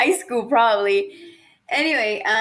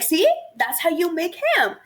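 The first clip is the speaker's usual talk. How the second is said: higher-pitched, insistent story quote; mock vindication; 7 semitones higher than usual. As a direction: play the wife proving her point. Acted vindicated reply in ham story.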